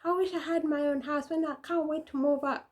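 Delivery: high, sing-song longing for a house of your own; whiny kid voice. Retold or imitated. Kid daydreaming to parents about moving out, high whiny voice. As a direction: pine for freedom like a moody teenager. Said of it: "we'd be like".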